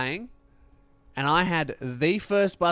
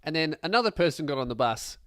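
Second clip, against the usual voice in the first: usual speaking voice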